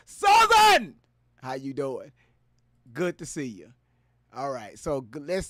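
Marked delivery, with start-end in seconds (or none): 0.1-1.0 loud, high shout of a name; excited call-out. Real, not acted. Streamer spotting a viewer in chat, shouting excitedly. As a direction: yell the name across the room.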